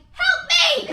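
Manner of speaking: high, loud laughing cry for help; mock desperate shout. Comic begging a happy couple, loud and laughing. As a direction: yell it like you're trapped. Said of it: "And you're like".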